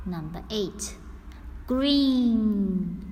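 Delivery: ordinary talk, nothing marked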